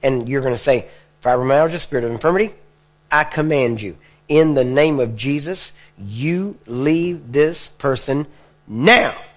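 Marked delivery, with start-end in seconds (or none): 1.2-9.2 plain at first, then slow and forceful on the command; normal then forceful. Shown as a demonstration. Preacher showing the congregation how to command sickness, normal then forceful. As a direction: build from normal talk to hitting the last words. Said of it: "You hear that? You go from talking normal to hit with your words".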